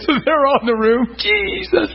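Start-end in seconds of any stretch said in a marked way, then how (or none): 1.2-1.9 high-pitched, weepy imitation of worship; mock tearful worship. Preacher imitating crying women at worship, mock tearful. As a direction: play an overcome worshipper crying out. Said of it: "and they're crying".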